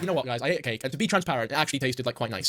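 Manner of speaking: deadpan a trivial confession; understated parody of an influencer; mock deadpan confession. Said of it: "It's like if I said".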